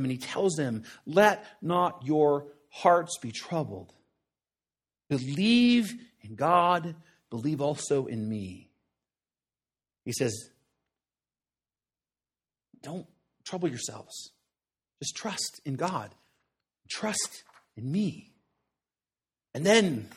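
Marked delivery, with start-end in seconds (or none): 1.1-8.7 gentle reassurance. A narrator's reading. Jesus comforts his troubled disciples, slow and gentle. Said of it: "Jesus seeks to encourage them". 12.8-18.3 much softer, slow and tender; quiet reassurance. A narrator's reading. Preacher paraphrases Jesus soothing the disciples, soft and tender. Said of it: "Jesus seeks to encourage them".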